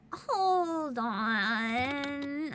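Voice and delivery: ordinary talk, nothing marked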